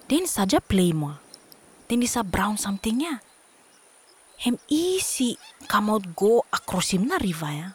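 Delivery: usual speaking voice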